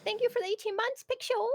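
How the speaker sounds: high, softened thank-you voice; cute little singsong thanks; sweet greeting. Real, not acted. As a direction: coo a high, gentle good morning. Streamer thanking a subscriber.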